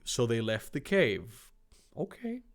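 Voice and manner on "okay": usual speaking voice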